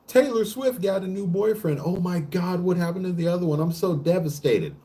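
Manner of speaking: hammed-up fangirl wail; mock devastated fan. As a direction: play a celebrity-obsessed fan melting down over gossip. Mocking a devastated celebrity fan.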